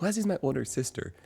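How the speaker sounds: friendly quoted chatter; imitating a friend. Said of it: "she was like".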